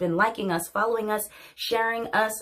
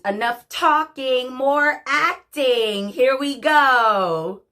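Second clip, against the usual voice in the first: rising higher and louder, drawn out; slow, building showtime call; excited kickoff; 5 semitones higher, 6 dB louder, slower than usual. Real, not acted. Host launching the monologue after announcements, excited kickoff.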